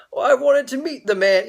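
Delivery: high-pitched imitation of a Swaziland accent. Ambassador greeting a young fundraiser in church, in a high Swaziland accent. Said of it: "he said it with his Swaziland accent".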